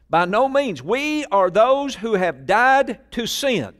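loud, high, drawn-out scripture proclamation; forceful preaching. Real, not acted. Preacher declaring scripture to the congregation, forceful. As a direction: proclaim the verse at full force.

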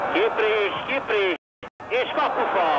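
high, urgent near-miss call; excited hungarian sports commentary. Real, not acted. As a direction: build up to a gasp at the post. Shot hits the post. (izgatott magyar sportkommentár)

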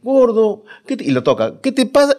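high anxious wife; scared wife. Retold or imitated. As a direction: voice the wife's fear as she touches him. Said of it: "la esposa estaba asustada".

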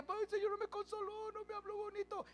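high, soft pouting whine; whiny self-pitying mock complaint; mock sulking. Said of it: "Está triste".

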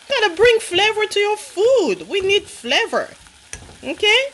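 emphatic high-pitched urging; excited, high. Real, not acted. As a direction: push the point up high and bright. Emphatic cooking advice.

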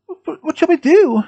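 high, alarmed stammering question; terrified. A narrator's reading. Frightened students as the school locks down, terrified. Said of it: "looked frankly terrified".

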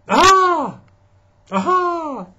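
very high, loud startled aha; surprised aha. Acted. Papa Bear opens the door and pounces, high and loud. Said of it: "said Papa Bear".

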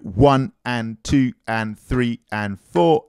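one, two, three and four stressed, the ands light; accent on the quarter notes. Shown as a demonstration. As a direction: lean on the numbers, slowly. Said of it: "you get the accent on the quarter note".

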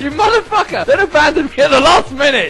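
high, loud outraged yell; furious shout. Real, not acted. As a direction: scream it at the deserter. Yelling at fleeing friend.

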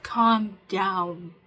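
loud, firm calm down. Shown as a demonstration. Coaching viewers to say it aloud, loudly. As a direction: declare it to yourself with force. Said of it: "say to yourself".

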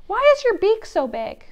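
high, loud rude little voice; rude penguin. Acted. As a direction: blurt it out tactlessly.

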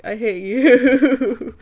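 loud, slow, laughing exasperation. Real, not acted. Fan laughing fondly at a clumsy character, drawn out.

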